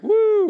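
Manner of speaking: a very high, very loud mock cheer; excited whoop. Christmas fans cheering, pastor whooping high and loud. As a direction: whoop like you love Christmas. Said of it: "some of us are like".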